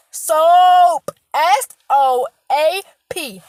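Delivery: Soap shouted, then S O A P spelled out slow and loud; stressing soap. Real, not acted. Lecturing viewers on hygiene: loud, spelled-out Soap. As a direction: spell it out letter by letter, loud and deliberate. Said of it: "I have to stress that".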